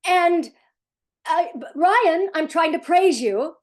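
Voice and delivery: frazzled parent forcing praise at a screaming son; mock frantic praise. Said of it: "and you're going".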